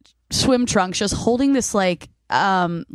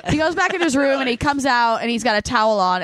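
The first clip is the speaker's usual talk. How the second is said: normal talking voice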